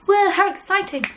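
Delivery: high-pitched excited exclamation. Real, not acted. Host cheering her knit-along and giveaway news, excited.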